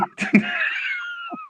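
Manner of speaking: high squeal of laughter. Real, not acted. Mocking a friend's exit, squealing laugh. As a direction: crack up at your own joke.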